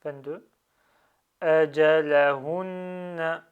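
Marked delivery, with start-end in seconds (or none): 1.4-3.5 "Ajalahunna" slow and loud, with a held double n; emphasised length. Shown as a demonstration. Teacher reads in one go, "Ajalahunna" slowly drawn out. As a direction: draw out "Ajalahunna", lingering on nn. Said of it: "J'essaie de souligner les prolongements".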